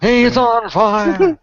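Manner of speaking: high, loud, drawn-out commentator shout; NBA Jam announcer. Acted. Friend echoes the game commentator impression, long excited announcer shout. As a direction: stretch it out like an arcade announcer. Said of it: "become one of the".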